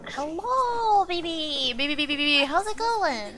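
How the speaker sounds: high, drawn-out singsong hello; cooing greeting. Real, not acted. Streamer greeting baby on a Sunday, high singsong cooing. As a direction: coo it high and playful.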